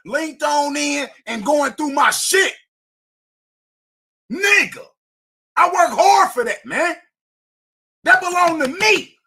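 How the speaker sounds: real angry shouting. Real, not acted. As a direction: yell it like he's defending his property. Furious rant about truck break-in.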